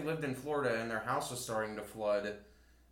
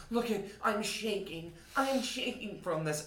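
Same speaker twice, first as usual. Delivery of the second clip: slow, high, softer mock fear; imitated frightened kid; 5 semitones higher, 5 dB quieter than usual. Oldest kid to the camera, imitated shaky fear. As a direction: draw it out like a scared child.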